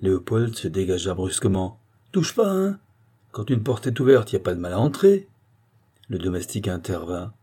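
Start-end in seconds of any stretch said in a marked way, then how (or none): 2.1-5.3 high-pitched, defensive protest; indignant retort. Acted. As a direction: push back at the man grabbing you. Rough retort to a grabbing concierge. (riposte indignée, voix montée dans l'aigu)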